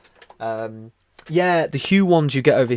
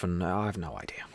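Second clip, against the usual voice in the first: whispering; 5 semitones lower, 9 dB quieter than usual. Real, not acted. Trailing aside to herself.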